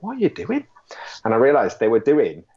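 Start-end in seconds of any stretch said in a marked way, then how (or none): 0.0-0.6 surprised. Retold or imitated. Shocked quote at strange behaviour.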